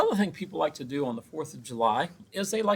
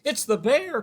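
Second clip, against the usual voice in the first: high, loud panicked cry; boy cries to mom about the bear; frightened cry; 11 semitones higher, 5 dB louder than usual. A narrator's reading. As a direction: cry it out scared. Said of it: "cried Eddie".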